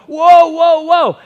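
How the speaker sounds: high, loud alarmed whoa called across a roof; startled yell. Retold or imitated. Contractor yelling at a kid running on the roof, loud alarm. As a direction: shout up to stop the running kid.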